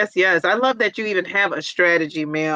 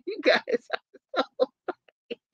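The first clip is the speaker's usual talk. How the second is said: laughing; 4 semitones higher, 4 dB quieter than usual. Real, not acted. Laughing at the comments.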